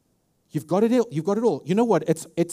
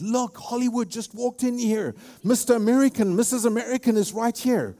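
higher, gushing impression of starstruck locals; starry-eyed South Africans; 6 semitones higher than usual. Preacher mimics starstruck South Africans greeting an American, higher and gushing. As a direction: gush like a fan spotting a celebrity. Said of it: "they starry-eyed".

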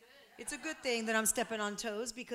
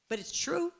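ordinary delivery

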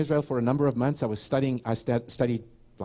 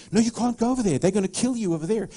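high-pitched, hurried mock alarm; alarmed warning; 8 semitones higher, 3 dB louder, faster than usual. Locals warn traveler not to go over there, alarmed. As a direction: imitate a worried local's protest. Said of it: "they would say".

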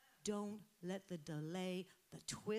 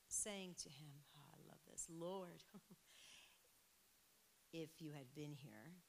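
grief; 2 semitones lower, 7 dB quieter than usual. Acted. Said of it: "you can hear the grief in her voice".

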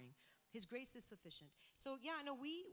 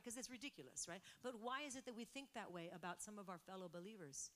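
ordinary talk, nothing marked

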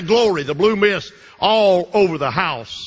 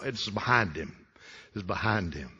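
usual speaking voice

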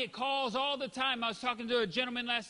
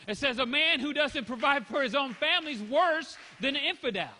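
louder, higher, hammering the verse home; forceful preaching; 6 semitones higher, 5 dB louder than usual. Real, not acted. Preacher to congregation, forceful and raised.

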